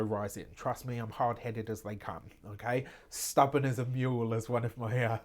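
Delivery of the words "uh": normal talking voice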